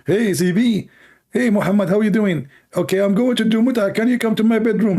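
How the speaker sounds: high, bright put-on voice of a friendly inviter; mock cheery invitation. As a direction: play the eager guy calling him over, pitched well up. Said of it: "That is a funny invitation".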